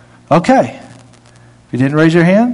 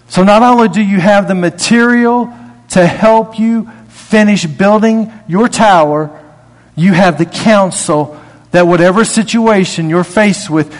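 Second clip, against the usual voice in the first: ordinary delivery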